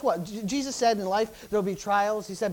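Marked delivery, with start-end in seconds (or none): none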